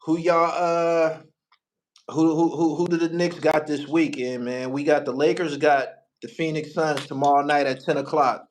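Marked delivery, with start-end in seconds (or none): none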